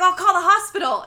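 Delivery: loud, high mock announcement; urgent shout. [name] announcing he'll call the hospital, loud and high. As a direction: call it out over the chaos. [name] grabbing control in emergency.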